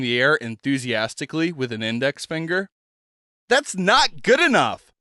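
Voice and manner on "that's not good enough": accusing and authoritative. Acted. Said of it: "her tone accusing and authoritative".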